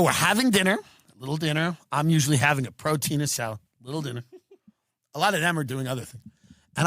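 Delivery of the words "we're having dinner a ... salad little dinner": mocking smart friends. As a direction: put on a prissy dinner-guest tone. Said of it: "They go".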